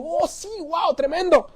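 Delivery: high-pitched gushing delight; acted thrill, voice leaping up; very excited. Young man hears he will meet God, thrilled. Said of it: "muy emocionado dijo".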